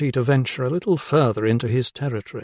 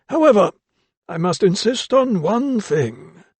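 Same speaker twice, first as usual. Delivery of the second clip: put-on old doctor; 8 semitones higher than usual. A narrator's reading. Old doctor voiced in story.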